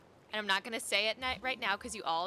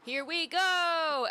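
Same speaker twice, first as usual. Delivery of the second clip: drawn-out, high, loud rallying cry; Peter Pan; 6 semitones higher, 6 dB louder, much slower than usual. Retold or imitated. Streamer recalling a Disney promo, excited Peter Pan cry. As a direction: call it out long and high. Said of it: "Peter Pan saying".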